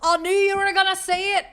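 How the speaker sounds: loud, high-pitched outcry; high exclamation. Acted. Streamer repeating a reaction to chat, high outcry.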